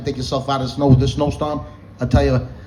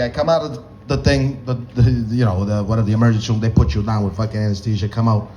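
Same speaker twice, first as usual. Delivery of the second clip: normal talking voice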